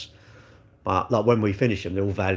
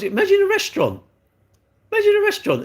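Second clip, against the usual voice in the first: much higher, exasperated disbelief; incredulous rant; 5 dB louder, faster than usual. Real, not acted. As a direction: push it up high, like it's absurd. Ranting about cars plugging in.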